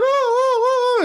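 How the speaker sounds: very high wailing "oh"; imitated onlookers' cries. Man mimics onlookers as dog attacks, high mock wail. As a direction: mimic a crowd's alarmed squeals. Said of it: "and everyone's going".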